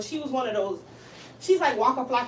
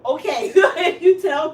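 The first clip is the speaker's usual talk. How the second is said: slow, loud, laughing through it; 4 semitones higher, 10 dB louder, slower than usual. Real, not acted. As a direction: giggle through the words. Laughing while telling.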